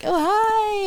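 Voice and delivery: high, sugary "Oh, hi" greeting; bubbly popular girl. Retold or imitated. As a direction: chirp it like a cheerleader.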